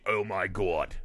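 low chain-smoker impression; smoked-out voice. Host picturing his own smoked-out future, low voice. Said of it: "that could be me".

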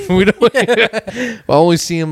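laughing through the words. Real, not acted. Laughing at the joke.

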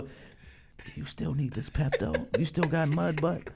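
hushed, half-laughing mock whisper; imitated whisper. Girlfriend on the red carpet trying to whisper, mock discretion. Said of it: "she trying to whisper".

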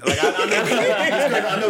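high, loud words through real laughter; laughing hard. Real, not acted. Co-host cracking up at a friend's story, laughing hard. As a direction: barely get it out for laughing.

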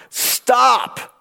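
high sharp call of stop; commanding stop. Retold or imitated. Moses halting the panicked crowd, high commanding call. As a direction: cut them off with stop.

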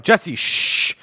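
loud name, then a forceful shush; retold sharp shushing; urgent shushing. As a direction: hush a panicking friend hard. Hushing the panicked coworker.

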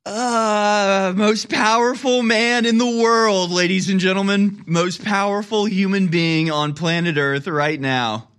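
high, slow mock-grand announcement; sarcastic awe. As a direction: overblown ironic tribute. Sarcastic praise of Biden.

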